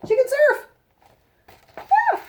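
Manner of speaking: high, loud, thrilled announcement; excited exclamation. Real, not acted. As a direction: proclaim it with delight. Excited repeat of the discovery.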